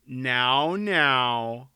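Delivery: slightly awkward soothing; awkwardly consoling king; awkward comforting. A narrator's reading. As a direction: soothe a wife clumsily. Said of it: "Folrock said awkwardly".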